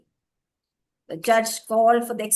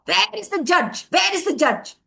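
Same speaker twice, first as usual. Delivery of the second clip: loud, high call for the judge; 7 semitones higher, 6 dB louder than usual. A narrator's reading. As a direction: call out across the square. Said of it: "they called out".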